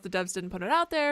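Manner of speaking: normal talking voice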